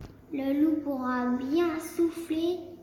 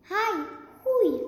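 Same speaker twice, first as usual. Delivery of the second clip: high, louder ouch; yelp of pain; 7 semitones higher, 3 dB louder than usual. A narrator's reading. Burnt wolf yelping in pain, high and loud. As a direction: cry out as if scalded.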